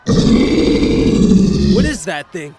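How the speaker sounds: a loud, very slow cry of alarm; frightened outcry. Acted. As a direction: drag the words out in shock at the beast. Monster erupts from the ground.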